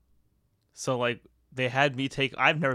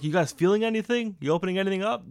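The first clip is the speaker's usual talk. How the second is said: teasing onlookers; 7 semitones higher, 4 dB louder, faster than usual. Retold or imitated.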